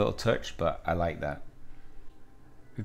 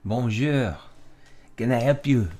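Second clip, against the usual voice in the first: slightly raised voice in a put-on French accent; 3 semitones higher than usual. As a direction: greet a customer with a French accent. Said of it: "gonna give Villanelle a French accent".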